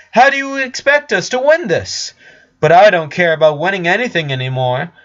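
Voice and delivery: high, loud and insistent. Acted. Flower calls to the hidden Richard, raising her voice loud and high. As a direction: call out across the dark warehouse. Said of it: "she ra-, rose her voice".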